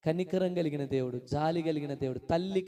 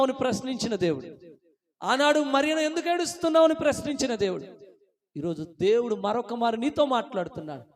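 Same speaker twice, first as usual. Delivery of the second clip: raised, driving preacher's delivery; impassioned preaching; 6 semitones higher, 5 dB louder than usual. Real, not acted. Preacher lists the family's troubles, impassioned preaching. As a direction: build the list with mounting force.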